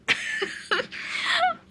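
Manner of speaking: very high, wheezy laughing fit; helpless tearful laughter. Real, not acted. Streamer losing it over their own joke, helpless laughter. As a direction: collapse into high, crying laughter. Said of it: "I'm losing it".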